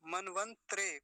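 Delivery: मनवंतरे ending in a joined "tre"; मनवंतरे with no vowel between त and र; wrong त्रे ending. Shown as a demonstration. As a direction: squash तरे into त्रे in मनवंतरे. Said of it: "कई बार त्रे कह देते हैं".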